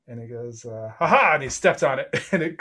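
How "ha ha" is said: higher, louder gleeful ha ha; triumphant ha ha. Acted. NPC stomps on the gem, gleeful ha ha. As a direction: crow with delight.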